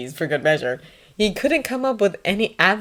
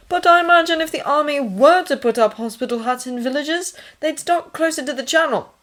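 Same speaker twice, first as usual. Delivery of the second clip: lightly voiced higher-pitched character; Thomas in conversation; 10 semitones higher, 4 dB louder than usual. A narrator's reading. Thomas talking hospital plans with the nurse, conversational. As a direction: lift the voice for his dialogue.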